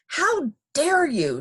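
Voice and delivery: high, slow, indignant outburst; angry shout. A narrator's reading. Drenched Miss Alma to her hosts, high indignant shout. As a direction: stretch each word in outrage. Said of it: "she shouted".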